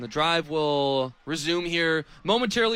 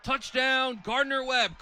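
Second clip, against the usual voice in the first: rising to a shouted, much higher call as he scores; excited touchdown call; 7 semitones higher than usual. Real, not acted. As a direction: call the touchdown at full throat, climbing. Touchdown run called live.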